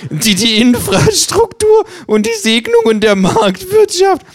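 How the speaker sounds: viel höher, langsam, durch Lachen gepresst; vor Lachen. Acted. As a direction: sprich es kaum noch vor Lachen haltend. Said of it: "Das Känguru fängt an zu lachen". (Känguru erstickt fast vor Lachen über die eigene Werbeparodie)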